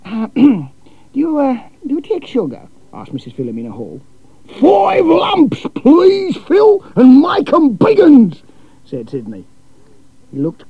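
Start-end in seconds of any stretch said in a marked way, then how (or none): 0.0-2.7 hesitant, raised, fussy lady's voice; a flustered hostess offering tea; timid hostess. A narrator's reading. 4.6-8.4 booming, much higher, slow Australian bloke; loud cheery Aussie, drawn out; brash Aussie cousin. A narrator's reading.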